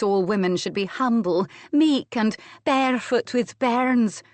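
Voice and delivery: teasing imitation of a Scottish accent, slowing down; gently mock his Scottish accent, drawing out the words. A narrator's reading. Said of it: "she gently mocked Adam's accent".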